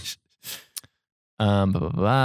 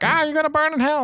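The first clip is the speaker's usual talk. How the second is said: high-pitched imitation of an angry heckler; mock protester yell. Imitates hostile protesters yelling.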